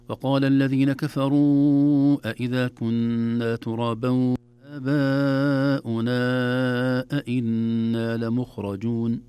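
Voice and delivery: slow, drawn-out tajweed chanting; Quran recitation. Shown as a demonstration. Sheikh reciting the verse slowly for students. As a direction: chant the verse with long held vowels.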